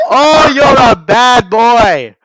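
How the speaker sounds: loud, high, slow hammed-up scolding; mock scammer scolding. Imitating scammer scolding them.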